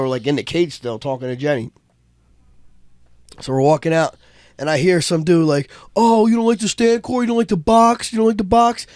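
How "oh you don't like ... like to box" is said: high-pitched mock heckling; playful taunt. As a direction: needle them playfully in a raised voice. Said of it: "Kinda playful".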